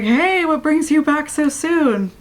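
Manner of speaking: a light cheery male voice, slowed down; lightly voiced Steve. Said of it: "Steve's like".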